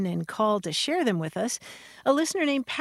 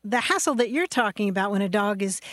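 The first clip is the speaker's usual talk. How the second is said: ordinary talk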